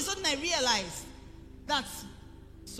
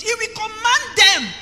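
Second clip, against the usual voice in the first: preaching shout; 8 semitones higher, 14 dB louder, slower than usual. Acted. Preacher to congregation, loud slow shout on command.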